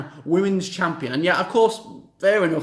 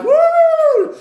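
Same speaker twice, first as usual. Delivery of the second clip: high, loud crowd woo; celebratory woo; 10 dB louder than usual. Retold or imitated. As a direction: whoop like the celebrating champ.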